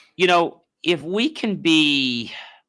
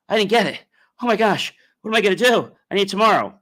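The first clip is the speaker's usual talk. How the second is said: imitating panic; 7 semitones higher, faster than usual. A needy person begging the universe, mock panic, pitched up. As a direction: ham up a frantic plea for the money. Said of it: "as opposed to".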